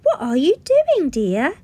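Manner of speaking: a mother afraid of her son; worried mum voice; nervous mother. Acted. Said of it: "asked Missus Bartleby nervously".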